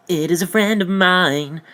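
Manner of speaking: alien's put-on voice. Alien man answering, high odd put-on voice. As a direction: answer in a strange creature voice. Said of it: "Look at him like".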